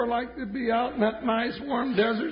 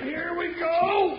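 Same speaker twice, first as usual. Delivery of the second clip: heave ho pulling; 5 semitones higher, slower than usual. Acted. Heaving beef at door.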